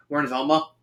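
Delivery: ordinary delivery, nothing marked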